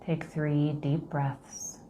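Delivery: slow, soft meditation voice; guided meditation. Acted. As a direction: gently guide them through the breath.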